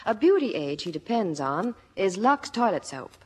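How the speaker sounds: ordinary talk, nothing marked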